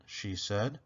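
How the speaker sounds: ordinary delivery, nothing marked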